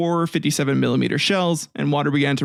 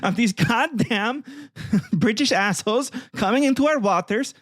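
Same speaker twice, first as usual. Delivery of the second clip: mock outraged Icelandic leader; 6 semitones higher than usual. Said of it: "They're like".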